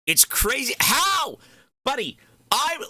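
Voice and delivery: high-pitched, disbelieving cry; incredulous outburst. Real, not acted. Streamer stunned by the support, high incredulous outburst.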